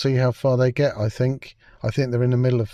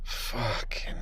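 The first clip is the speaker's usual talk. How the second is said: slow, stunned whisper; a hushed, slow whisper of dismay; whispered swear; 9 semitones lower, 14 dB quieter, much slower than usual. Real, not acted. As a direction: breathe it out in disbelief. Disbelief at the Doctor's backstory.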